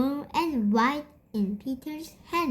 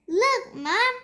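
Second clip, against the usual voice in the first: put-on higher eager little-boy voice; Peter showing off; 9 semitones higher than usual. A narrator's reading. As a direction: show Mom proudly. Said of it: "said Peter".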